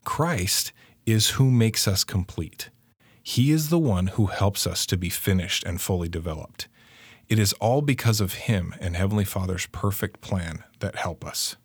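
normal talking voice

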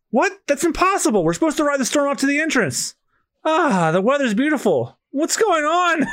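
high, startled disbelief; shocked crew voice. Acted. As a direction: react as if the storm vanished. Voicing shocked crew in manga.

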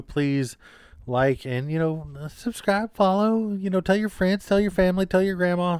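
rally the listeners; excited outro plea. Real, not acted. Asking listeners to share the podcast, excited.

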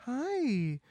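high, slow sing-song greeting; sweet greeting. Acted. Ozma greeting the forgetful Gnome King, high and slow. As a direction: speak sweetly high like to a child. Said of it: "she's like".

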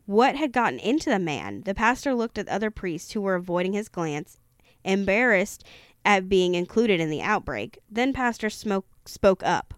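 ordinary delivery

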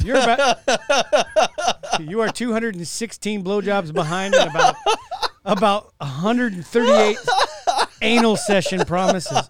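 laughing while talking; giggling through the count. Real, not acted.

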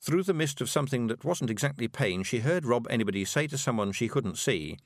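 ordinary delivery

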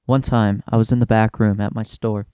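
ordinary delivery

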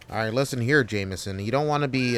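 ordinary delivery

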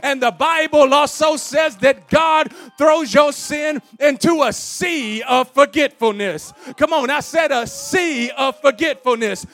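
shouted, high-pitched pulpit climax; full-force preaching. Real, not acted. Preacher urging church to stand and praise, full-force shouted preaching. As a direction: shout each phrase to bring the congregation up.

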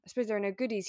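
ordinary talk, nothing marked

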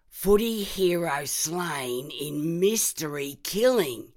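slow, loud headline announcement; headline read. Acted. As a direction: read it like a front page banner. Reading newspaper headline aloud.

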